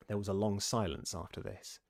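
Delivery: ordinary delivery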